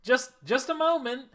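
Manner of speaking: high call from inside; shouted call. Acted. Unseen smith calling to the knocking party, high shout. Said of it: "someone, uh, shout".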